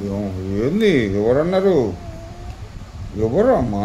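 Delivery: loud, sharp protective questions; indignant loud demand. Real, not acted. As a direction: demand who did it, loud and upset. Mother hears child was insulted. (కోపంగా గట్టిగా అడగడం)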